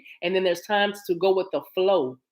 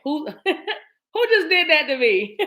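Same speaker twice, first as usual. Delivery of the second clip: slow, high laughing disbelief; 8 semitones higher, 4 dB louder, slower than usual. Real, not acted. Asking viewers who knocked the cards, laughing. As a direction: laugh through the question.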